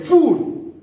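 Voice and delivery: a sudden high, loud "Fool."; God's rebuke. Retold or imitated. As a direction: fling "Fool." out as God's verdict. God rebukes the rich farmer.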